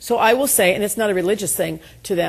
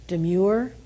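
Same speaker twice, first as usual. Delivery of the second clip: usual speaking voice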